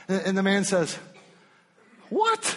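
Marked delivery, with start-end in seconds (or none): 2.1-2.5 high sharp surprised yelp; incredulous what. Acted. Healed man stunned by the Pharisees' reply, incredulous. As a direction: react in disbelief.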